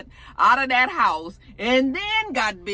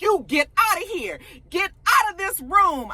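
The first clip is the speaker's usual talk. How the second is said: retold angry order; 8 semitones higher than usual. Retold argument, ordering her out.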